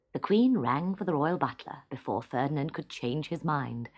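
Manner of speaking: ordinary delivery, nothing marked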